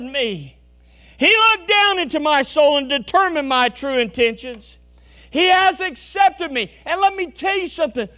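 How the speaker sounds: high, loud revival preaching; fiery preaching. Acted. Preacher to congregation, loud fervent preaching. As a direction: preach it hard and high to the pews.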